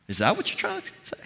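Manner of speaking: quick, high, rising query; pointed mock-innocent question; sceptical questioning. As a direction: press the inner critic in mild disbelief. Challenging the inner voice.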